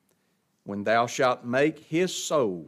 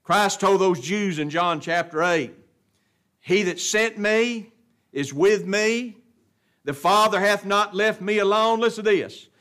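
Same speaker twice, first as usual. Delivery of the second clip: high, loud, long-stretched key words; forceful preaching; 7 semitones higher, 9 dB louder, slower than usual. Real, not acted. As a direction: build to a ringing proclamation.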